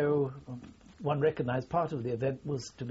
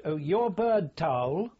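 higher, louder officer's call; retold shout; 5 semitones higher, 6 dB louder than usual. A general to a junior officer at a shoot, mock shout. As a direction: call it out like a general imitated in an anecdote. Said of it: "a colonel or a general would shout".

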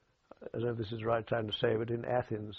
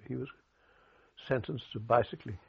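ordinary talk

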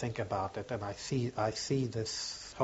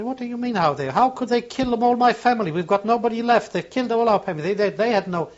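high, louder, furious retort in the women's voices; a raised, anguished protest; bitter outcry; 9 semitones higher, 8 dB louder than usual. Retold or imitated. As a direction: fling it back, nobody left to spare. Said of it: "They say".

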